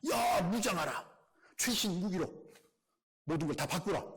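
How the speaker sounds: high shouted order to arm up; mock royal command to arm; commanding king. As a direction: bark it like a panicked general.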